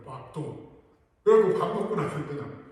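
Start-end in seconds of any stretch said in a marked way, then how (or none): 0.0-0.8 a terse Gyeongsang 밥도, muttered softer; lightly imitating a curt Gyeongsang husband; softer, clipped Gyeongsang demand for dinner; Gyeongsang dialect. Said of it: "경상도 말로".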